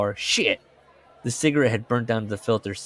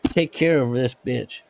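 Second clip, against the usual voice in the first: a hint of a pushy coworker, pitched up at the start; a touch of an annoyed employee's ultimatum; lightly voiced Lenny; 6 semitones higher than usual. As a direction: demand it, half-hearted. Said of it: "Lenny calls Joe and is like".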